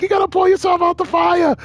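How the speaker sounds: high-pitched English corner coach urging his fighter; a coach in an English accent, pitched well up; high English accent, pushing a downcast fighter. Retold or imitated. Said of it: "they all got those English accents".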